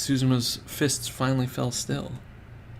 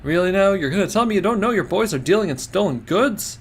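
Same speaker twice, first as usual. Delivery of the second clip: higher, louder, sing-song disbelief; mocking accusation; 7 semitones higher, 5 dB louder than usual. Reporter accusing the gang leader of theft, mocking and loud. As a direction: needle him with mock disbelief.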